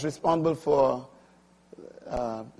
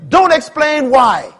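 very high, loud, slow preaching; forceful command; 11 semitones higher, 13 dB louder, slower than usual. Acted. Preacher driving home the lesson, forceful command. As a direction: hammer out "don't explain why".

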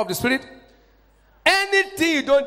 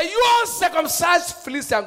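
David's open challenge; 5 semitones higher than usual. Acted. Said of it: "David came out in the open".

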